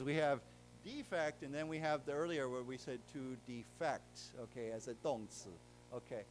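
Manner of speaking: ordinary talk, nothing marked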